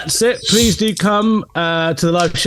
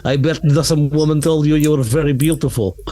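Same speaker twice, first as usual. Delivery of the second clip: put-on Russian accent. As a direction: fake Russian accent, lightly done. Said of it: "You literally did a Russian accent".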